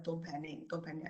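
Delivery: quietly repeating to yourself in a hush; hushed self-talk. Retold or imitated. A panicking person hushing themselves. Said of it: "I tell myself".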